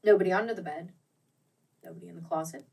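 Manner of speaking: ordinary delivery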